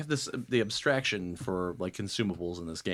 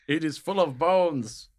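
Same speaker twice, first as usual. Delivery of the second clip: potato hole man voice; 6 semitones higher, 6 dB louder, slower than usual. Pretend. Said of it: "Speak the voice of the potato".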